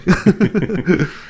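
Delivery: short high-pitched laugh. Real, not acted. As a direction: burst into a quick laugh. Laughs at brass kettle joke.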